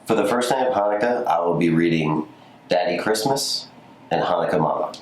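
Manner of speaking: ordinary delivery, nothing marked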